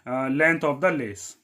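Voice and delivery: drawn-out dictation pace; slow dictation. Shown as a demonstration. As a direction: stretch each word as you write it. Teacher dictating while writing.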